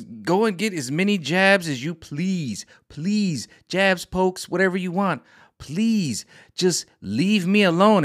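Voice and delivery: high, slow, begging to be left alone; exasperated plea. Retold or imitated. Fed up with the jab talk, pleading to be left alone. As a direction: throw up your hands and beg them to back off.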